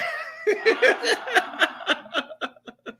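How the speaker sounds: long, high laughing fit; amused cackle. Real, not acted. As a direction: burst into a high, drawn-out laugh. Cracks up at a viewer's comment.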